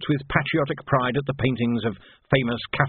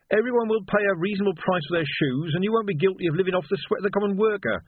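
curt revolutionary; 9 semitones higher than usual. Acted. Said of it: "said Reg shortly".

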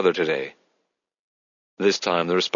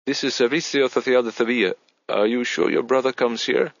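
put-on higher-pitched voice with Spanish accent; doubtful Spanish receptionist; 5 semitones higher than usual. A narrator's reading. Agency receptionist to German tourist, doubtful. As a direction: politely question the caller in a Spanish accent.

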